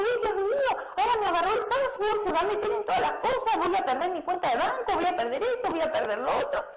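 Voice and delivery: high, rushed mock panic; a hammed-up fretting voice. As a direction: play a panicked victim, half laughing. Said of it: "Entonces uno dice".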